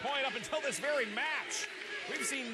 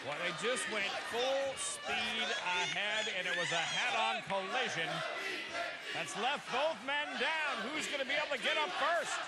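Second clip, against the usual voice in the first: wrestling commentator. Acted. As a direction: stretch out the big collision. Colour commentary on collision.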